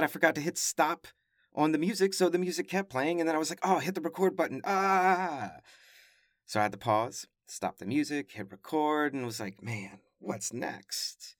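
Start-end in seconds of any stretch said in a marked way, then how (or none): 3.6-5.6 mock panic. Podcaster retelling forgetting the record button on stage, mock panic. 9.6-11.4 softer, slow, resigned aside; mock weariness. Podcaster retelling his reaction to live-show mishaps, mock weariness.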